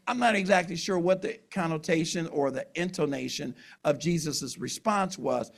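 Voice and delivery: normal talking voice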